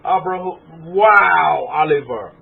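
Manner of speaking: ordinary delivery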